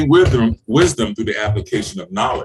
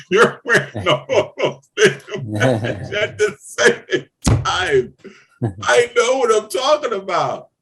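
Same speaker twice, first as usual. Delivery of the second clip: laughing while speaking; 8 semitones higher, slower than usual. Real, not acted. Coach to CEOs, laughing about many hats. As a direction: chuckle through the words, slowly.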